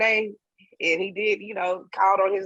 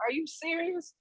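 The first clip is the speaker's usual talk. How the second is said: high, soft stunned question, much higher and softer; disbelief; 10 semitones higher, 9 dB quieter than usual. Retold or imitated. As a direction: gasp it out like you can't believe it. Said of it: "I'm looking at her like".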